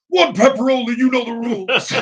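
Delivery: loud, high wrestling promo voice. Pretend. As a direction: hype it like a wrestling announcer. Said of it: "Wrestling promo voice".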